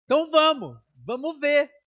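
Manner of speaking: rally the brothers with full excitement; excited rallying call. Retold or imitated. Pastor calling the brothers to go see the house, fired up.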